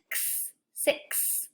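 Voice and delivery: x as a whispered ks, then six ending on ks. Shown as a demonstration. Teacher linking the x sound to six, whispered. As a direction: hiss ks, then echo it in six. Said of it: "do you hear it at the end?".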